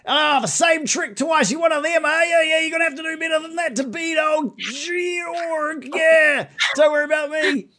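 high, loud, taunting creature shout; a cocky brute mocking the attacker; gruff boasting bugbear. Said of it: "maybe he was a little more ready".

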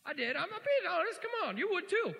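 high, shouted insistence; loud protesting. Real, not acted. Preacher defending his joy over a raise to congregation, loud protest. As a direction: insist at full voice, pitch way up.

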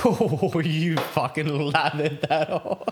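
laughing in disbelief, slow. Real, not acted. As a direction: crack up while talking. Friend drowns wing in sauce.

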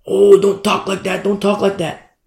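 loud mock "oh dear" complaint; mock protest. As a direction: mimic a scandalized listener objecting. Acted objector scolding the preacher.

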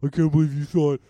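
imitating someone in a loud put-on voice; hammed-up, loud complaint; loud mock indignation. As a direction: blurt it out in mock disbelief. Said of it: "in normal voice after crazy voice and be like".

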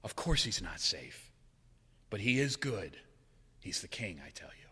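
preacher repeating the line he loves, softly; moved. Real, not acted. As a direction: say it gently, close to tears. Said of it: "that line still puts tears behind my eyes".

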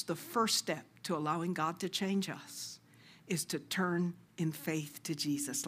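ordinary talk, nothing marked

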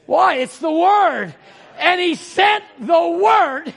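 loud, slow shouted preaching. Acted. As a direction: preach it with full force. Preaching at full force.

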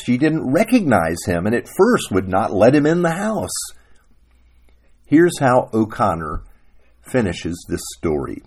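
usual speaking voice

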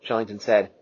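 ordinary delivery, nothing marked

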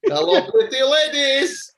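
put-on very high, loud, slow comic accent; Rabina Berry. Mocking his own slipped accent, hammed-up Rabina Berry impression. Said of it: "that's like Rabina Berry".

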